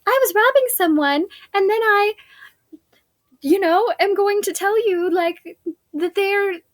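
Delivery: high, squeaky mock confession of a thief; mock robber voice. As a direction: squeak it out like a silly robber giving himself away. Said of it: "What person is gonna be like".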